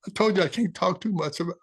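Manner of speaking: the words start to break as tears come; choking up. Real, not acted. Grateful interviewee starts tearing up talking about his change. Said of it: "I do this every time".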